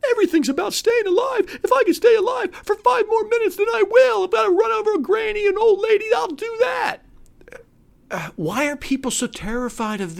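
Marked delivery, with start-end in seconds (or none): 0.1-7.0 high, loud frantic shouting; mock frantic coward. Mocking survival-obsessed people.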